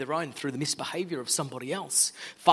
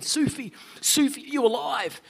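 high, urgent call into the dark; 9 semitones higher than usual. Acted. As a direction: call out desperately for a friend. King calls into dungeon.

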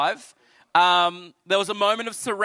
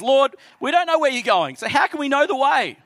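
raised, louder questioning disciple; Thomas asking; 7 semitones higher, 4 dB louder than usual. Acted. Thomas pleading with Jesus, higher and louder.

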